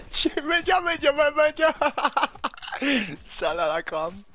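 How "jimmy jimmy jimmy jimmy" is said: much higher, louder squealing; high squeaky repeated calling; squealed name. Real, not acted.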